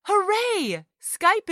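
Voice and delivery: high, bright cheer of joy; excited cheer. Acted. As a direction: cheer as the call connects. Cheering that the call works.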